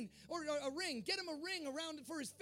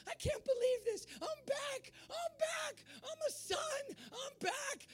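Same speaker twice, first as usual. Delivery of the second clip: much higher, giddy disbelief; overjoyed son; 11 semitones higher, slower than usual. Acted. Returned son to the congregation, high giddy joy. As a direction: burst out like a forgiven son who can't believe it.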